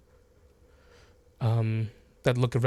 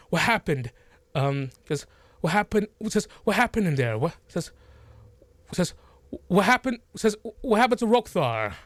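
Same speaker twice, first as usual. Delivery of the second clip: raised, urgent questions from the crowd; alarmed camp folk; 7 semitones higher than usual. Acted. Camp folk crowding Lee, alarmed and urgent. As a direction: press for answers, worried. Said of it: "immediately you hear".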